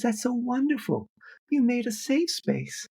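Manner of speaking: put-on high, eager young voice; young activist gushing. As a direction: gush like a wide-eyed young activist.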